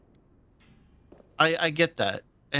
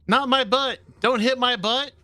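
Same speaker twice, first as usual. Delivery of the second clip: squeaky, higher indignant plea; high-pitched protest; 9 semitones higher, 4 dB louder than usual. Real, not acted. Character hit from behind in game.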